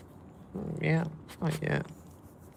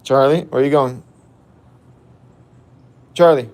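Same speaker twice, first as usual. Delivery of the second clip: loud call across the yard; 13 dB louder than usual. Real, not acted. Owner calling his wandering dog back with a loud shout. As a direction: shout the dog's name to call him back.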